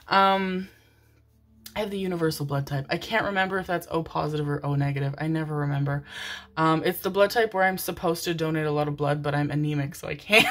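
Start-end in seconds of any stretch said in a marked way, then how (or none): none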